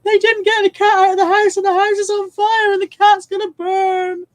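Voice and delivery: retold childhood crying. Telling chat how she cried at the film, hammed-up child's wail. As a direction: wail it out like an upset eleven-year-old. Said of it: "what are you crying for".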